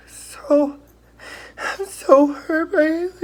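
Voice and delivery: sobbing the words out over heartbreak; crying. Real, not acted. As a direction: heartbroken crying, pitch high and cracking. Crying on her bathroom floor.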